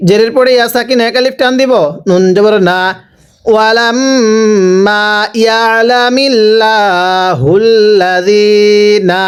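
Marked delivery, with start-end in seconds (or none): 3.5-9.2 slow chant with held hums and vowels; Quran recitation. Shown as a demonstration. Teacher strings the syllables together, slow recitation. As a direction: recite in measured time, stretching লা and জি.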